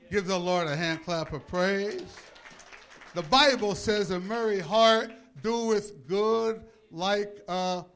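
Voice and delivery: loud, high scripture proclamation, slowing at the end; full-force preaching. Real, not acted. Preacher urging praise and quoting the Bible, booming proclamation. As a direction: rouse the congregation at full voice.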